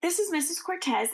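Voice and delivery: ordinary delivery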